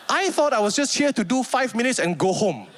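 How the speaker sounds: ordinary talk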